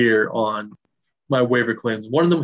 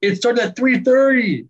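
much higher, indignant protest; really worked up, pitch shooting up; salty; 10 semitones higher, 3 dB louder than usual. Real, not acted. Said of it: "J J salty".

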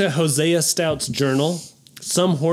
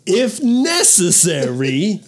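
slow, drawn-out, higher pitch; slowed dramatic reading; 4 semitones higher, 3 dB louder, much slower than usual. Pretend. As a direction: stretch it out slowly. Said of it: "Let me slow it down".

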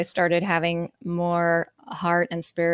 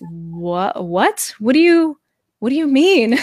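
rising to a high, loud what do you mean; shocked disbelief; 5 semitones higher, 5 dB louder than usual. Retold or imitated. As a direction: ask it like your jaw just dropped. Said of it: "I was like".

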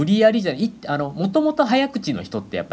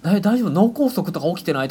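high, worried questions; 9 semitones higher, 3 dB louder than usual. Retold or imitated. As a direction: sound concerned for someone. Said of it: "勝手に心配しちゃってですね".

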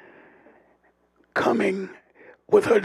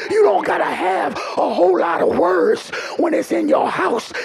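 ordinary talk

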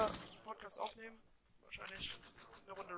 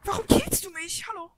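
loud angry shout; raging gamer; 10 semitones higher, 10 dB louder than usual. Real, not acted. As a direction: yell at the teamkiller. Teammate gets teamkilled in game. (wütender Gamer)

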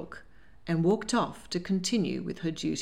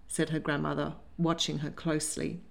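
ordinary delivery, nothing marked

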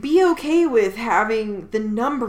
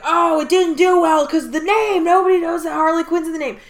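mocking; 7 semitones higher, 8 dB louder, faster than usual. Mocking studio excuse.

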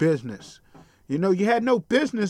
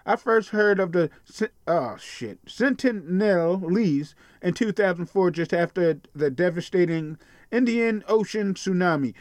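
ordinary talk, nothing marked

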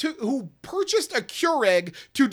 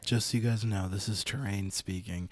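low announcement; 13 semitones lower, 4 dB quieter than usual. Real, not acted. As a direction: voice dropped very low.

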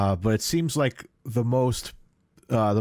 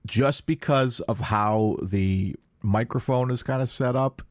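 ordinary delivery

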